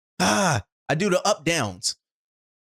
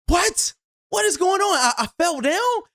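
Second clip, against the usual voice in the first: shocked disbelief; 12 semitones higher than usual. Retold or imitated. Retelling a surprise fall.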